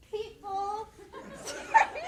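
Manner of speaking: high, laughing apology; cracking up. Real, not acted. Student apologizing mid-speech to the audience, cracking up. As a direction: lose the word to real giggles.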